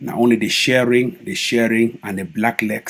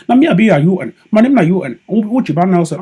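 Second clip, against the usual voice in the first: high, loud put-on American twang; mocking fake American accent; 7 semitones higher, 6 dB louder than usual. Vlogger mocking a leader's fake American accent, high put-on twang. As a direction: mimic him showing off a foreign accent. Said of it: "Only the way the Fed talk, you hear accent".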